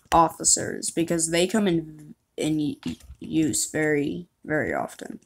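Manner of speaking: ordinary talk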